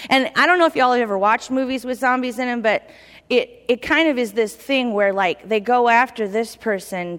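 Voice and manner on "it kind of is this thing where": normal talking voice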